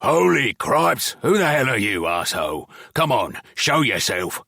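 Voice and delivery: loud, startled goblin bellow; angry roar. Acted. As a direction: goblin yelling at the intruder in his cell, angry. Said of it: "an angry roar".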